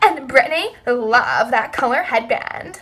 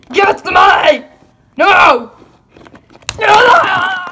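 louder, heated demand and refusal; quarrelling shout; 4 semitones higher, 5 dB louder than usual. Acted. Boys fighting over stolen money, angry shouting.

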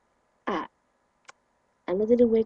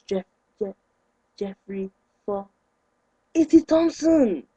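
mock stumbling teacher; 2 semitones lower, 4 dB louder, slower than usual. Mocking the teacher stumbling over his name, then blurting. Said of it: "When it comes to the fresh boy".

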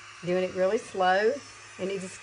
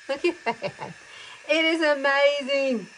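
excited; 7 semitones higher, 4 dB louder, slower than usual. Real, not acted. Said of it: "I get real excited over this".

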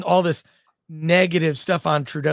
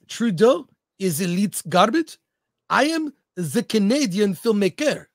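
a jokey, put-on Canadian French accent; 4 dB louder than usual. Host reads a chat comment aloud in a hammed-up Canadian French accent. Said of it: "that's my Canadian French accent".

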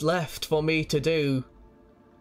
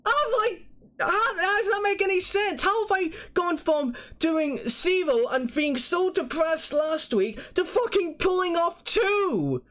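stunned disbelief; 13 semitones higher than usual. Real, not acted. Disbelief after the big win.

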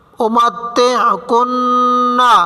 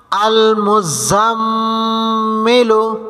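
recited reading; slower than usual. Shown as a demonstration. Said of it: "teacher recites the word".

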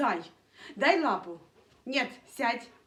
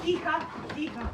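ordinary talk, nothing marked

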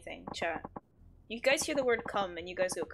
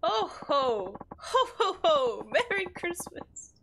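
drawn-out, higher ho-ho-ho; hammed-up Santa greeting, slow; mock Santa; 6 semitones higher, slower than usual. Streamer clowning with a Christmas greeting, mock Santa.

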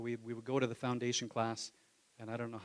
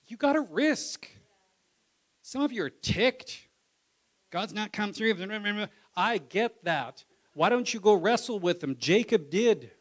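loud, high preacher's call to risk; full-force preaching; 7 semitones higher, 11 dB louder than usual. Acted. Preacher urging the church to wrestle with God, loud and high. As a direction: preach it hard to the congregation.

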